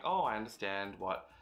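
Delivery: normal talking voice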